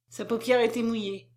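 plain reading voice

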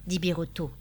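usual speaking voice

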